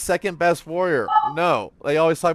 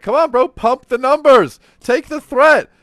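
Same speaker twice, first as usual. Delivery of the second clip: imitating a guildmate yelling high and loud; hammed-up hype shout, pitched up; mock yell; 10 semitones higher, 10 dB louder than usual. Retells guildmate yelling in dungeon.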